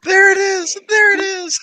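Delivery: high, loud celebratory yell; excited shout. Real, not acted. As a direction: shout it like a fan cheering. Cheering the Step Brothers reference.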